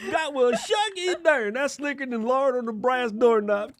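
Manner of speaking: high hammed-up North Carolina country accent; mock country accent. Mimics the AI's country voice.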